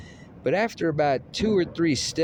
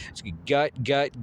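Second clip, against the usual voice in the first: ordinary delivery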